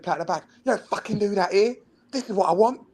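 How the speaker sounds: high mock coach rant; angry coach. Coach scolding player about playing out, high mock rant. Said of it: "You said".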